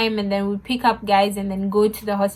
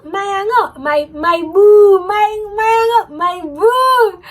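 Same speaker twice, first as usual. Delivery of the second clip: higher and louder, swooning over her boo; lovestruck excitement; 8 semitones higher, 6 dB louder, slower than usual. Real, not acted. Sees her boyfriend arrive.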